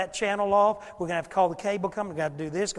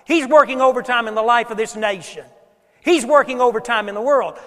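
preaching; 3 semitones higher, 8 dB louder than usual. Acted. Full-force preaching.

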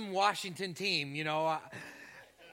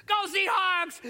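put-on high, loud little boy cheering back; excited kid; 13 semitones higher, 9 dB louder, slower than usual. Boy in Seahawks uniform answering the pastor, high kid's shout. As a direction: shout it back like an eager young fan. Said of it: "And he goes".

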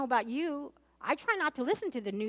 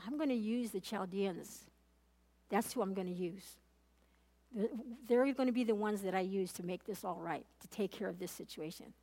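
a hint of God's calm, lower voice; voicing God; 4 semitones lower, 6 dB quieter than usual. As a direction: lower and softer, calm divine pronouncement. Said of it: "And God says to him".

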